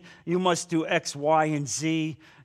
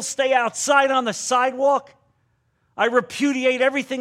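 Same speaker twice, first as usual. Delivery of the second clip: louder, higher mock scorn; mock condemning church voice; 5 semitones higher, 5 dB louder than usual. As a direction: act out the judgmental churchgoer shutting her out. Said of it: "There's no repudiation".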